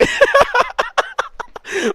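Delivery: very high squealing laugh; shrieking laugh. Real, not acted. Narrator cracking up at his own story, high squealing laugh.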